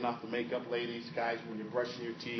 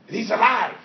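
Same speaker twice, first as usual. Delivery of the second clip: higher, louder eager insistence; excited Rafiki; 9 semitones higher, 15 dB louder than usual. Acted. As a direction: burst out with the good news.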